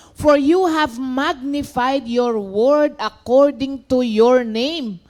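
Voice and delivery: preacher reading a psalm aloud to the church, slow and solemn; scripture reading. Acted. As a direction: proclaim the verse slowly to the congregation. Reads psalm to congregation.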